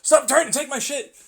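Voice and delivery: high, loud hammed-up yell; mock angry yell. Victim yelling at the one grabbing his stuff, high and loud. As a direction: bark it at the thief.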